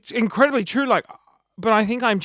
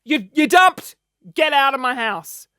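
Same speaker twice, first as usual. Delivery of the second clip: louder mock telling-off; mock angry; 3 semitones higher, 6 dB louder than usual. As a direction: kick them out in play. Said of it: "I'd be like".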